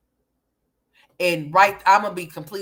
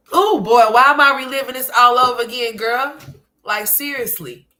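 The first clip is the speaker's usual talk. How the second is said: exasperated outburst; 6 semitones higher, 7 dB louder than usual. Real, not acted. Exasperated at reliving the pain.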